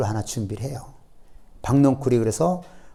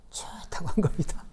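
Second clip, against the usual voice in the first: whispered with amusement; soft whispered aside; amused whisper; 3 semitones higher, 10 dB quieter than usual. Real, not acted. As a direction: hush it like a shared joke. Amused aside about Jonah.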